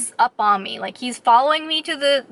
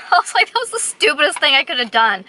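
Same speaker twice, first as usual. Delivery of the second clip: laughing at herself; 4 semitones higher, faster than usual. Real, not acted. Laughing at own mistake.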